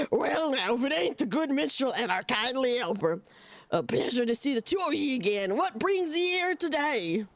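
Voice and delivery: lightly voiced old pirate accent; a hint of a growly sea pirate. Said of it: "I can only do one pirate voice".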